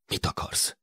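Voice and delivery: frightened whisper. A narrator's reading. Jill whispering into the radio, trembling with fear. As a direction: breathe it, afraid of being heard.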